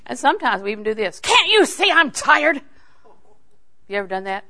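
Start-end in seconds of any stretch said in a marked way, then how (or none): 1.2-2.6 high, loud, stretched-out mock complaint; exasperated snapping. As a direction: wail it loudly in exaggerated exasperation. Acted snapping at spouse, for laughs.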